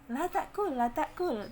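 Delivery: "La ta'kul" high with thin vowels, falling; smiling pronunciation. Shown as a demonstration. Teacher repeats the example, smiling thin letters. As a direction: say "La ta'kul" through a smile. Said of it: "Tapi sambil senyum".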